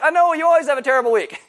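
impatient friend cutting off the whining; exasperated retort. Acted. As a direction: roll your eyes and rattle it off. Mock reply to complaining friend.

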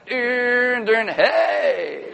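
drawn-out sung-out fanfare into hey; mock a grand comeback entrance; playful entrance fanfare. Preacher imagining his own resurrection, playful showy fanfare.

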